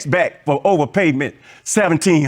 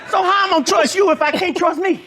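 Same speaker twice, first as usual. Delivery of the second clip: high, chuckling through the words; laughing; 8 semitones higher than usual. Real, not acted. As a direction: laugh as you land the point. Laughs through his punchline.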